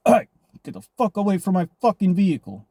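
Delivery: yelling at a stranger at the car door, retold; retold angry yell. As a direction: bark him away from your car. Yelling at man trying car doors.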